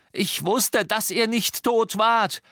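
high, strained yell; heated, high-pitched shouting; bellowed. A narrator's reading. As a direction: roar over her to drown her out. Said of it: "brüllte Ron und übertönte".

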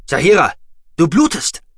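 loud, high cry of alarm. Acted. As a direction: call out her name in shock. Sees her bleeding, alarmed.

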